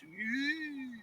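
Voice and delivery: high, soft crowd "ooh", rising; imitated audience "ooh". Audience reacting to a spit in the face, imitated ooh. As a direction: imitate a scandalised crowd, softly. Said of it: "the whole audience goes".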